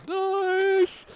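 high delighted squeal; excited squeal. Real, not acted. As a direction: squeal with joy at the find. Finds shotgun shells.

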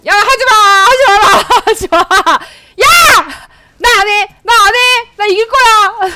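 high, loud playful shouting; laughing yells. Real, not acted. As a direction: yell at them, laughing in protest. Yelling at enemies while laughing. (웃으며 외치는 항의)

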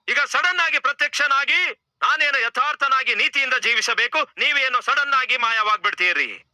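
high-pitched complaint to God; indignant protest. Acted. Man protests to God who appears then vanishes, high indignant complaint.

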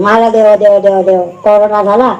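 low, even-toned retelling; deadpan mimicry. Preacher mimicking a devotee and doctor, low deadpan. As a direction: rattle off the mock exchange low and dry.

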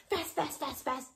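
rapid-fire repeats; fast. Shown as a demonstration. Teacher showing children fast by rattling it off. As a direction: rattle the word off quickly. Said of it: "fast and slow".

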